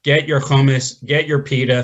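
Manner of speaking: ordinary talk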